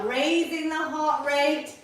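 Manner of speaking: ordinary delivery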